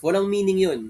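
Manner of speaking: usual speaking voice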